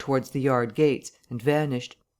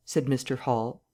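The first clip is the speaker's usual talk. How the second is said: plain reading voice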